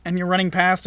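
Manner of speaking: ordinary delivery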